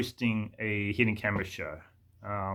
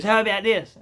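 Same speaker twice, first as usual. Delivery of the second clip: high, louder mock tradie; put-on voice, pitched up; imitated tradie question; 8 semitones higher, 5 dB louder than usual. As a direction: toss out a suggestion as the tradie. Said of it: "He goes".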